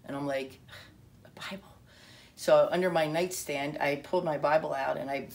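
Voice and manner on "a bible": much softer, as if taken aback; hushed disbelief. Retold or imitated. Narrator echoes a friend's question about a Bible, hushed. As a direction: drop to a very soft, puzzled echo.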